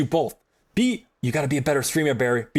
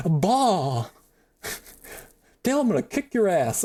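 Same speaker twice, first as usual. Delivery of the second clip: Hank Hill impression. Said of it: "I'm going to do a Hank Hill".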